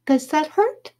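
high, tender little question; put-on girly concern; fawning girl. A narrator's reading. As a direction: ask it in a high girlish voice. Judy touches lightly, then presses.

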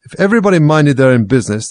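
loud, hoarse growling voice. Acted. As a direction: growl it out roughly. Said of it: "in a hoarse growl".

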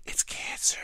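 hushed mock terror over symptoms; hammed-up whispered panic; mock dread. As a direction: whisper it like doom is certain.

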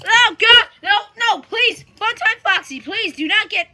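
yelled plea for mercy; panicked pleading. Pretend. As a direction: beg in hammed-up panic. Pleads with Funtime Foxy, panicking.

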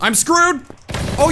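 high, loud cry of alarm; real frantic outburst; panicked shout. Real, not acted. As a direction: yell it as all is lost. Repeats it in panic.

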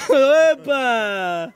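loud, high, drawn-out cry; excited cheer. Real, not acted. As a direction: shout it at the fireworks. Cheer at fireworks.

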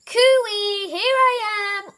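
high, loud singsong call; slow, drawn-out cooey to be found. A narrator's reading. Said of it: "It called".

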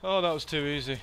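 1 triumphant gloat. Real, not acted. Easy kill in the game.

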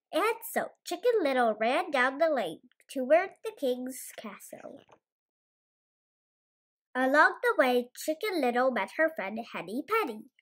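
ordinary delivery

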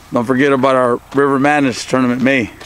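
usual speaking voice

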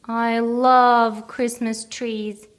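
slow, loud and beaming; grinning. A narrator's reading. Teddy gushes to Big Bear, loud and slow. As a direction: savour it with a big grin. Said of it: "grinned the little bear".

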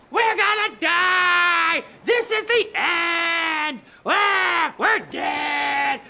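slow, loud panicked yelling; panicked shout. Acted. Disciples hug each other, screaming in panic. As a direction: scream like the boat is sinking.

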